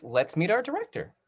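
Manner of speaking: ordinary delivery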